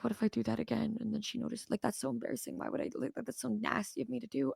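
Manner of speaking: very soft retold inner voice; hushed worry. Retelling worry about jolting in sleep, hushed. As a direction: fret to yourself, barely audible. Acts out private worry at bedtime.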